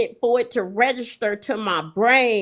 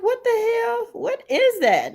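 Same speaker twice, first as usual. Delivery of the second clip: high-pitched puzzlement, slowing as it drops; retold bewilderment; 10 semitones higher than usual. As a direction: start high in disbelief, then draw out the question. Retelling her bewildered first reaction.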